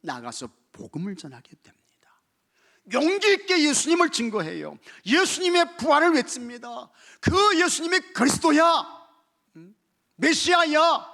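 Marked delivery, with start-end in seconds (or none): 2.9-8.6 loud, high preaching cry; shouting the gospel from the pulpit; fiery preaching. Acted. Preacher proclaiming at full force. 10.2-11.1 loud, high preaching cry; shouting the gospel from the pulpit; fiery preaching. Acted. Preacher proclaiming.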